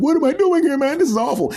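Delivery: very high, whiny complaint; whiny victim. Acted. Tortured man whining to the devil. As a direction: whine in misery.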